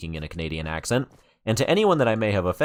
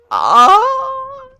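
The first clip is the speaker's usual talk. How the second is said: very high, loud squeal of delight; excited squeal; 12 dB louder than usual. Real, not acted. Overwhelmed by Dawn's build, a loud high squeal. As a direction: let out a huge shriek of awe.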